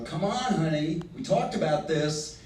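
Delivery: soft, coaxing nudge; a hint of sweet persuasion; wife coaxing her husband to go ahead. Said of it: "is she joining in and giving him that extra".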